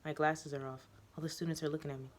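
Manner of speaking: plain reading voice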